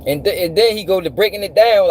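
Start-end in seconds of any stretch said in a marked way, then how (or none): none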